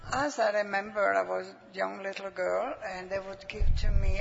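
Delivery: ordinary talk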